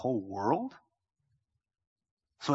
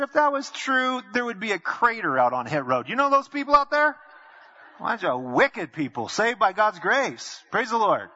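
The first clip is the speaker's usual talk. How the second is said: higher, louder, laughing comeback; pastor's retort; 8 semitones higher, 7 dB louder than usual. Retold or imitated. Pastor answering the excuse, loud and laughing. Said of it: "I said".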